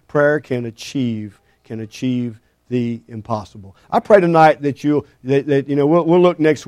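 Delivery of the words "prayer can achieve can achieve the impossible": slow, soft, emphatic; hushed slow declaration; quiet conviction. Real, not acted. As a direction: lean in softly on the impossible. Sermon climax delivered softly.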